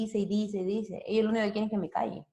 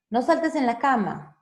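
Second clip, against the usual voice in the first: louder, higher telling-off; scolding parent; 5 semitones higher, 5 dB louder than usual. Shown as a demonstration. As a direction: tell the child off. (regaño de mamá)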